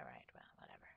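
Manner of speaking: unvoiced "Well, whatever"; whispered shrug. Real, not acted. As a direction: mutter it dismissively.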